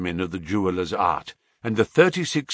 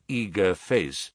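usual speaking voice

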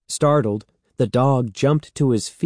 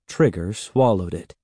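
ordinary delivery, nothing marked

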